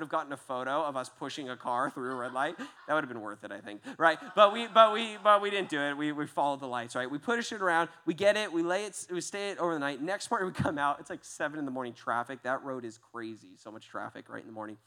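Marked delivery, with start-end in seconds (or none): none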